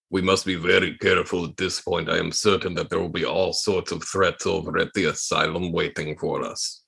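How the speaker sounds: a solemn warning, pitched deep and slowing at the end; Gregorian Ilhausen voice. Acted.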